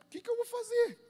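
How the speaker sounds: high, loud desperate plea; acted desperation. Pastor retelling his plea to God over treatment, desperate. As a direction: cry it out high, reliving the panic.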